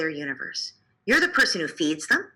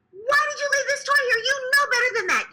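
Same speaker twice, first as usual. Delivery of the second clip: put-on screaming at the child. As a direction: shriek at the child over the toy. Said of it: "scream at the child".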